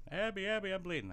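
high-pitched plaintive mock child; child calling for help. Child telling the babysitter he's bleeding, high mock plea. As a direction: call out high, as a hurt kid.

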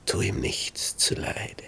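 slow hushed whisper; calm him down under your breath. A narrator's reading. David hushes Abishai at night.

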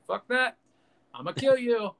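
high-pitched acted threat; hammed-up defiant movie villain; Anakin. Anakin rejects the plea to come back, high mock threat. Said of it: "Anakin's like".

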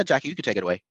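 ordinary talk

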